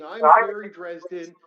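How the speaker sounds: loud retold scream of his name. A man banging on a stranger's door, screaming.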